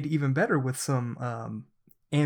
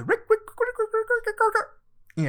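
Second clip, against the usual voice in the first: echo effect. Pretend. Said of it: "I didn't do that last time".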